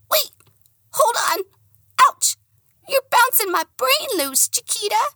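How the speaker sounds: very high squeaky yelping dog; dog complaining. Acted. The dog in the bag crying out, high squeaky yelps. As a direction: yelp like a bounced little dog.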